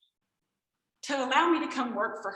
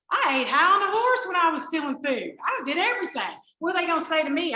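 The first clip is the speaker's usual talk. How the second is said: defiant swagger; 7 semitones higher, 8 dB louder than usual. Retold or imitated. Reliving defiant drinking days.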